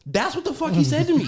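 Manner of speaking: high, laughing outrage; incredulous laughing rant; laughing in disbelief. Real, not acted. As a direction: laugh it out, can't believe it. Outraged retelling of the insult.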